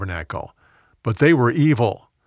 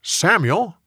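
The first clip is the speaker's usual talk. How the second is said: high, rising call of the name; divine calling voice; 6 semitones higher than usual. A narrator's reading. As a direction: call "Samuel" upward like a voice from afar. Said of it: "the Lord called out".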